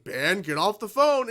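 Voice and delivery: hammed-up call across the dinner table; mock stern parent. Parents telling Ben to hang up, mock stern and loud. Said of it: "they keep saying".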